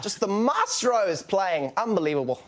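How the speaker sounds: South African commentator gushing over every shot; overexcited South African tennis commentary; Robbie Koenig impression. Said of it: "Can you do the South African voice?".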